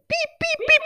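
high squeaky monitor beeps; beeping monitor noise; imitated heart monitor. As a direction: chirp like a hospital monitor. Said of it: "heart monitor thingy is like".

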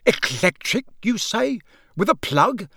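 high, eager questions; excited. A narrator's reading. Wizard fascinated by electric fire, excited. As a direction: marvel with keen curiosity. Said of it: "said Mister Weasley's voice excitedly".

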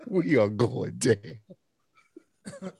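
extremely drawn-out words; deliberate, dragged-out doom announcement; slow pronouncement. Real, not acted. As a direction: stretch it out as a slow verdict. Repeats the verdict very slowly.

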